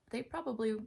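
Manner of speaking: ordinary talk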